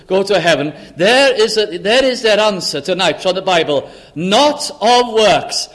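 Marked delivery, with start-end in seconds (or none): 4.1-5.7 each word drawn out, slow and louder; preacher's emphatic slow scripture; slow proclaimed verse. Acted. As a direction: proclaim it slowly, weighing every word.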